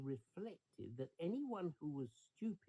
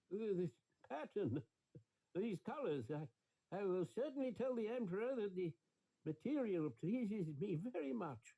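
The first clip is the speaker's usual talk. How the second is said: a lightly voiced old minister, stammering and raised; flustered old minister; 5 semitones higher than usual. Old minister bluffing to the swindlers about the cloth, raised stammer. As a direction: stumble over the words, bluffing praise.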